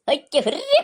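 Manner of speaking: high, loud hammed-up wail; mock wail. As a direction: wail it out in exaggerated mock grief. Fake wail in mock quarrel.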